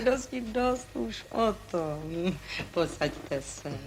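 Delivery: slow and soft, closing the subject; hushed slow end of argument; quiet, slow dismissal. Acted. Cutting off the quarrel.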